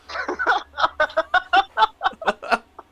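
high-pitched real laughter; long burst of giggling cackles; laughing hard. Real, not acted. Comic cracking up at his own bit, high laughter.

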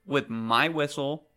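loud, emphatic villain scolding; indignant castle owner laying down the law; angry confrontation. Acted. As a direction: demand an explanation, hitting the words hard. Villain confronts intruder in her castle.

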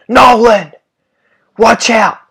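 sudden high yell of warning; alarmed shout. Retold or imitated. Warning shout in near crash.

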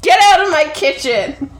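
loud, laughing shout to get out; hammed-up kitchen tantrum; mock yell. Teasing her co-host, a laughing mock yell to get out. Playing an angry cook.

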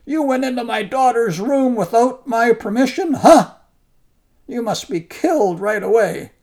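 roaring at the monk in fury, high and loud; an enraged giant shouting insults; raging giant. Acted. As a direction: bellow it like a furious demon. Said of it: "burst into a rage".

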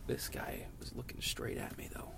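hushed secret whisper, breath only. Real, not acted. Vlogger whispering about a driver staring at him. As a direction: drop to a whisper so he can't hear.

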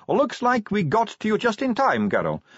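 teasing friend. Acted. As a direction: tease her with a knowing grin. Sally teasing Angua at bar.

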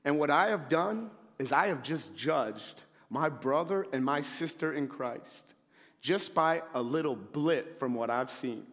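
ordinary talk

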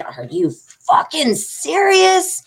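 high, slow, incredulous outburst; furious retold question; irate. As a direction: let the disbelief explode, drawn out. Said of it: "I was irate".

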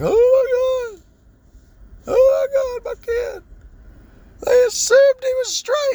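very high, hammed-up wailing voice; mock hysterical parent. Mocking parents panicking over a t-shirt, high wailing voice. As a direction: overplay a sobbing panicked parent. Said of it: "And we constantly like".

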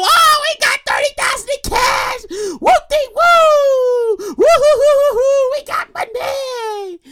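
high, whooping family hysterics; hysterical celebration. Acted. Family celebrating the found cash, hysterical. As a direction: whoop and stretch it out, voice way up. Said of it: "the family gets all hysterical".

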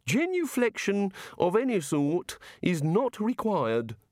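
high, slow, inquisitive god voice; innocent, measured wondering; curious, genial god. Acted. God asking wizards how it all works, innocently curious.

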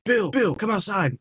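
hint of a drunk friend at the window. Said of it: "He's like".

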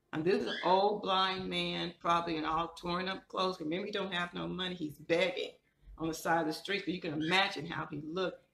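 ordinary talk

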